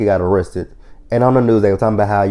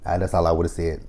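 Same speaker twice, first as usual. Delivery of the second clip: normal talking voice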